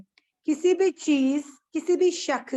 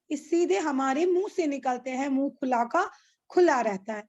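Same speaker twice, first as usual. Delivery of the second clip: normal talking voice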